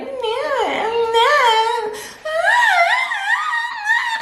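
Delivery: very high squealing nahs; high girly squeal. Retold or imitated. Girls gushing about guys they like, squealing very high. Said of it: "And our voices just get so high".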